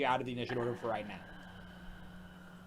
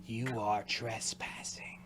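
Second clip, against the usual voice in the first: slow, breathy whisper of a rotting goddess; 3 semitones lower, 4 dB quieter, slower than usual. Acted. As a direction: hiss it like an ancient spirit. Goddess accuses trespasser.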